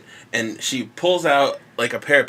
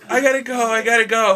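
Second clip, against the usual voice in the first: put-on frantic girl; 10 semitones higher, faster than usual.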